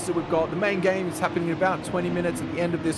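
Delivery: high, bright broadcast welcome; announcer voice. Acted. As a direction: pump it up like a live host. Announcer welcomes viewers tuning in.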